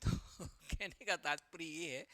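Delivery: usual speaking voice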